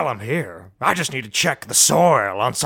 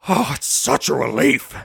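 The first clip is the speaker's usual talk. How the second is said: ordinary talk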